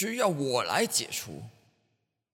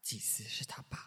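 whispered aside; 5 semitones lower, 17 dB quieter than usual. Acted.

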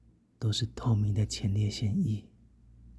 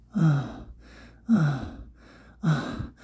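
higher, falling panted moans; short moans; 5 semitones higher, much slower than usual. Acted. Narrator acting out exertion, short moans. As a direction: let out brief moans of effort. Acted intimate scene, wordless moans.

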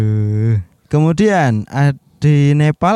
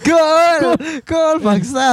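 goal celebration shout; 14 semitones higher, much slower than usual. Pretend. Mock football goal celebration.

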